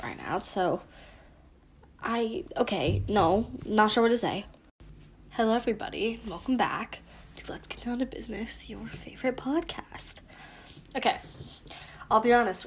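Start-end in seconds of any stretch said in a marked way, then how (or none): 5.3-10.2 hushed whisper of the show intro; whispered podcast intro. Acted. Host whispering the opening of her podcast. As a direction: breathe the welcome out without voice.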